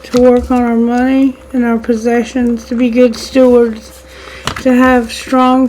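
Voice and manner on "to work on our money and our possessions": usual speaking voice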